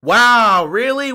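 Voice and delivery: loud, drawn-out mock astonishment; big fake wow; sarcastic amazement. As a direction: act stunned by the obvious. Sarcastic reaction to coach's quote.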